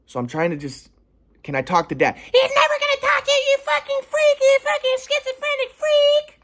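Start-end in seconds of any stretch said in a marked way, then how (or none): 2.2-6.4 mock screaming mom. Mom screaming insults at her son, mocked shriek. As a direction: play the raging mom, hammed-up shriek. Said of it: "Screaming at me".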